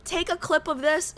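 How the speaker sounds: ordinary talk, nothing marked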